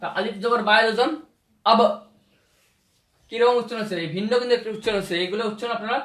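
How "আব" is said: আব with the ba bouncing at the end; qalqala bounce. Shown as a demonstration. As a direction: jump the final b of আব. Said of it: "একটা করে উচ্চারণ দিয়ে দেখাচ্ছি". (কলকলা দিয়ে আব)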